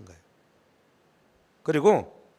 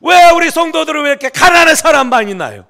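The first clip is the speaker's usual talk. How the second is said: complaining prayer; 12 semitones higher, 17 dB louder than usual. Retold or imitated. Pastor retelling his own complaint to God, as a loud bitter outcry. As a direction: reenact crying out bitterly to God. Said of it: "제가 막 불평했다니까요".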